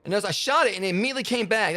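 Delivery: high, loud disbelief; shocked that it happened again; surprised. Real, not acted. Glitch repeats, shooting in game.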